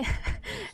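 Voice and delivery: high breathy little laugh; short giggle. Real, not acted. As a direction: giggle breaking into the story. Amused before telling funny part.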